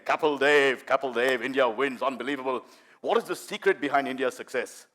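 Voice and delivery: imitated BBC interviewer, low and restrained; Peter West. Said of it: "guy called Peter West from B B C".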